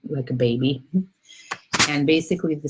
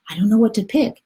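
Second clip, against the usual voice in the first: put-on high, louder childlike dilemma; puzzled little soul; 8 semitones higher, 5 dB louder, faster than usual. Little soul answers God after thinking, puzzled and high. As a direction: voice a small soul stumped by choices. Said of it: "the little soul thinks and thinks".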